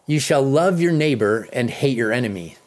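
normal talking voice

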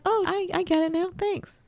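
high, quick voice of dawning relief; sudden relieved understanding. Retold or imitated. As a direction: play the grateful quick penny-drop. Acted grateful reply to friend.

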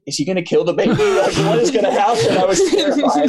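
rising pitch through laughter; amused retold panic. Host recalling a tense TV episode to co-hosts, amused panic. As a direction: laugh through a mock-worried recollection.

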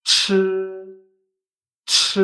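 CH loud, with a strong puff of air; vibration with air. Shown as a demonstration. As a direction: push a burst of air through CH. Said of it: "vibración con aire".

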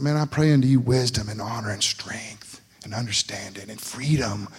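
praying voice. Retold or imitated. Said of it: "It's like".

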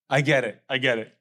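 ordinary delivery

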